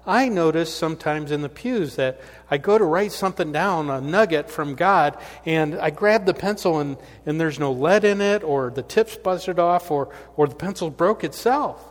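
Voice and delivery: put-on ninety-eight-year-old lady venting about pencils to her pastor, fired up. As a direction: let her frustration spill out with energy. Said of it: "And she says".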